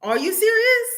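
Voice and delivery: high, loud outcry of disbelief; shocked disbelief. Retold or imitated. Reacting to the Instagram video, high loud disbelief. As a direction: throw the question up high in shock. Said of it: "I literally was like".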